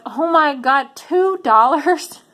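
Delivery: high, drawn-out disbelief at the price; wife hearing the pumpkin's sale price, incredulous and slow. Retold or imitated. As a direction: stretch out two dollars in shock. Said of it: "that I was like".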